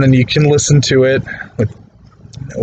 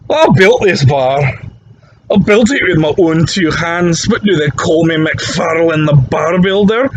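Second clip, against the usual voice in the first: put-on higher-pitched old Scottish pub drunk, boasting; a proud old Scotsman bragging over his pint, pitched up; old Scottish barfly; 5 semitones higher, slower than usual. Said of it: "the old man pops up and he goes".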